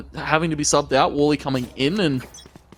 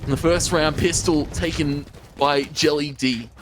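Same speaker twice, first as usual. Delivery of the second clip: rising excited play-by-play, higher; esports caster; 4 semitones higher than usual. Acted. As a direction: call the clutch live, climbing in pitch. Live play-by-play of round.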